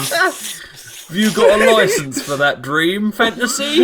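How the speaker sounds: high, slow cockney copper; British bobby. Pretend. Player joining in as a hammed-up London bobby. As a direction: ham up an old London policeman. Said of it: "in that voice".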